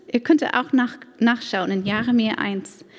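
normal talking voice

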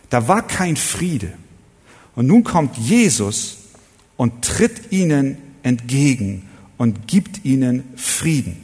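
usual speaking voice

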